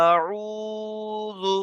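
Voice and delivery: আউযু with the আ held one alif, slow and high; stretched vowel. Shown as a demonstration. Teacher drilling the madd. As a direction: hold the first vowel long. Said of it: "একালিপ টান দেবেন".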